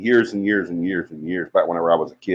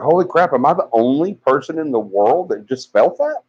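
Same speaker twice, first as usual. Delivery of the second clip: shocked disbelief; 6 semitones higher than usual. Retold or imitated. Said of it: "And I'm like".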